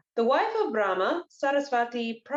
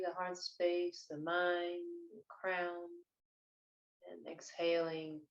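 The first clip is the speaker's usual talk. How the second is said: unhurried, hushed breathwork narration; guided meditation; 2 semitones lower, 5 dB quieter than usual. Acted. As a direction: lead them gently into stillness, phrase by phrase.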